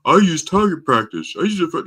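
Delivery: high, loud mocking voice; mocked gun owner. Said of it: "someone says, like".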